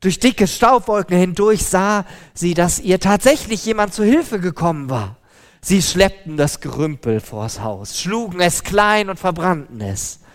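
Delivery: usual speaking voice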